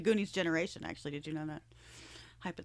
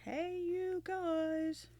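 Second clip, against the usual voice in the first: Sloth voice; 9 semitones higher, much slower than usual. Pretend. Goonies quote, Sloth voice.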